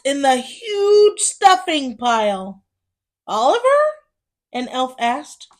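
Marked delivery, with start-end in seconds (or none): 3.3-3.8 high, loud questioning call. Acted. Elf calling out for lost Oliver, surprised.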